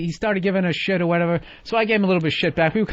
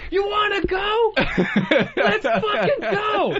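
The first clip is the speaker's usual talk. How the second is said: very high, slow mock threat; action movie tough guy; 13 semitones higher, slower than usual. Mocking Joe squaring up like an action hero. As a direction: ham up a macho challenge. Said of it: "like he's in an action movie".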